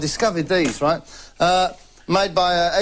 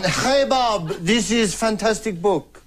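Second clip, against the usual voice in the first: mock Spanish accent; 4 semitones higher, slower than usual. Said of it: "he comes home and go like".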